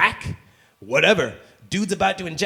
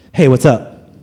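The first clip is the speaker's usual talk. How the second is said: acted text greeting; 4 semitones lower, 13 dB louder, slower than usual. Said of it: "another one that's like".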